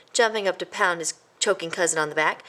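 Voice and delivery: plain reading voice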